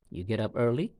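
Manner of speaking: "early" going up at the end as a question; rising intonation. Shown as a demonstration. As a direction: model a yes-no question with "early" lifted. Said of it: "Can you feel the rising intonation at the end of it?".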